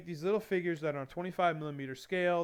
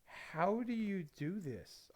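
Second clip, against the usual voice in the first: drawn-out, slow mock amazement, softer; slow amazed question; 4 semitones higher, 6 dB quieter, much slower than usual. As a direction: stretch it out, stunned and impressed. Coworkers amazed again.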